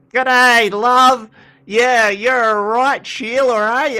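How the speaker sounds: loud, high-pitched mock Aussie bloke; broad Australian accent. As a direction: ham up the Aussie drawl, loud and drawn out. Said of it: "this Australian guy's like".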